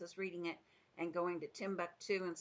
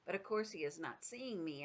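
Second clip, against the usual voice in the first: plain reading voice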